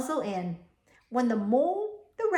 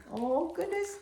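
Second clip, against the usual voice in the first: mock amazement; 6 semitones higher, 4 dB quieter than usual. Amazement shown to children.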